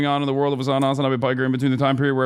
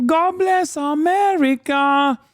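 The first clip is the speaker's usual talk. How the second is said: mock patriotic; 14 semitones higher, slower than usual. Mock patriotic cry after song.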